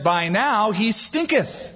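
slow, savoured "stinketh"; King James line. Retold or imitated. Preacher relishing the old translation, slow. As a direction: stretch out "By now he stinketh" for comic effect. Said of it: "She said".

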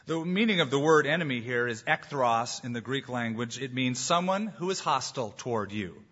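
ordinary talk

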